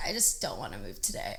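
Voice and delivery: groggy morning. Retold or imitated. Waking up stiff and sore, a low tired groan. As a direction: sound like you can barely get out of bed. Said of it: "I wake up and I'm like".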